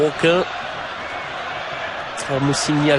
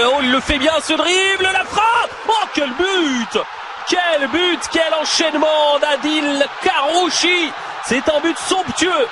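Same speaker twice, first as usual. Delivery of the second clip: excited sports commentary; 8 semitones higher than usual. Real, not acted. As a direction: erupt as the goal goes in. Goal call, commentator erupts.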